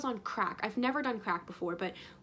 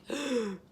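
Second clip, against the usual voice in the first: a shocked intake of breath; sharp gasp. Retold or imitated. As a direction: gasp in dismay. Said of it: "And I'm like".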